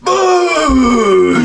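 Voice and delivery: high, loud mock battle cry; hammed-up martial arts shout; karate yell. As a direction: bellow it like a drunk karate chop. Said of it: "be like".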